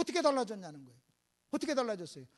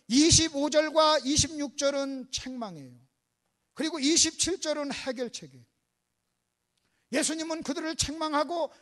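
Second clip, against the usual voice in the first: ordinary talk, nothing marked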